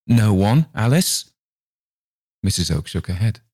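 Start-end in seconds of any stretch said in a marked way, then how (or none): 0.0-1.3 higher, suspicious repeat; scrutinizing. A narrator's reading. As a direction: doubt every word she says. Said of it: "in a scrutinizing tone".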